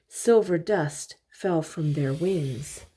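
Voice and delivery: usual speaking voice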